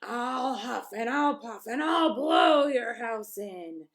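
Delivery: loud, slow roaring shout; furious wolf at the brick house. A narrator's reading. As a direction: roar it out furiously. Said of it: "he roared".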